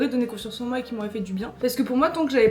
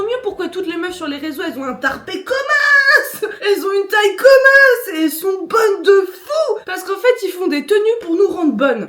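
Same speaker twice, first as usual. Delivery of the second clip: excited rant; 6 semitones higher, 11 dB louder than usual. Real, not acted. Ranting about outfits, worked up.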